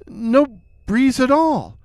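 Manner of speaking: ordinary delivery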